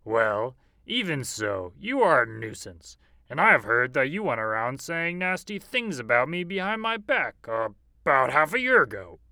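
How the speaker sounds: bully wolf building his excuse. A narrator's reading. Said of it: "said the wolf".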